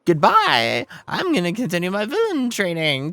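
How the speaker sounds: loud, high, boastful villain; cartoon villain. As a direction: ham it up as a cartoon boss. Villain Boss Stone speaks.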